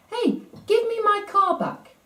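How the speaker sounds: high mock yell of a small boy; imitating a four-year-old shouting for his toy; retold child's yell. Boy yells at the teasing manager, high mock shout. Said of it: "Tom yelled out".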